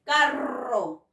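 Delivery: carro said slowly with a long trilled rr; strong rolled rr. Shown as a demonstration. Teacher models carro's trilled rr for the class. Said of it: "porque suena fuerte".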